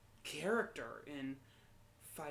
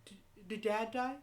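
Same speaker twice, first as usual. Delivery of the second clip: put-on a frightened little kid, voice lifted and louder; small scared voice, higher and louder; scared child's question; 6 semitones higher, 5 dB louder than usual. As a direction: ask it like a nine-year-old already dreading the answer. Child waking to mother sobbing.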